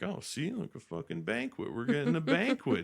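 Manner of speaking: high, mock excited brag; gleeful boasting. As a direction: crow about the banquet. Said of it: "they're like".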